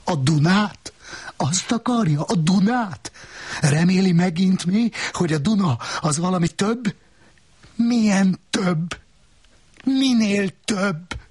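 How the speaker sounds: high-pitched, incredulous questioning; agitated arguing. A narrator's reading. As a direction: fire back indignant questions at a high pitch. Heated quarrel, voices raised.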